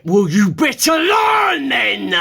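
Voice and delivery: a harsh inner voice answering the panic; stern inner voice. Retold or imitated. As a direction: snap back at yourself, drawn out. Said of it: "And in this voice just went".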